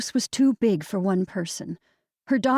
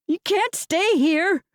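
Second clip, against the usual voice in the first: high, slow, tearful; tearful plea; 10 semitones higher, 3 dB louder, slower than usual. A narrator's reading. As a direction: plead through tears, slowly. Crying to the bird.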